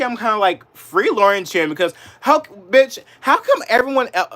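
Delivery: heated outburst, pitch climbing; real anger boiling over; getting mad. Real, not acted. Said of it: "now I'm getting mad".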